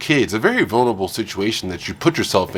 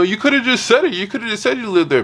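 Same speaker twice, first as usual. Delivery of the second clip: high-pitched mock complaint; high, mocking quote of an excuse; mimicked excuse; 10 semitones higher, faster than usual. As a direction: imitate her protesting in a raised pitch. Mimics woman's excuse from the clip.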